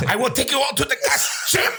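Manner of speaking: high fast German dictator; German accent. Pretend. Hitler impression.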